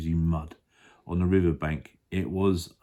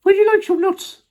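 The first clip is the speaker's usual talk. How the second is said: very high, loud monkey voice; Mugglewump; 10 dB louder than usual. Acted. Monkey offering nuts, very high and loud. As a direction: squeak out the offer loudly. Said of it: "asked Mugglewump".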